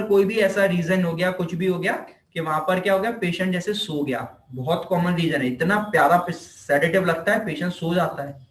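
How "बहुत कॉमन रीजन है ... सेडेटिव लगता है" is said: usual speaking voice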